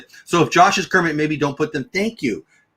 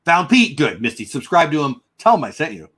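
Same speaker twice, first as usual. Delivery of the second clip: ordinary talk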